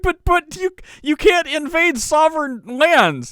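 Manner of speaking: very high, slow, stammering objection; mock indignant protest. Host mimics officials protesting invasion to Trump, mock indignant. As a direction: whine it out as a helpless protester.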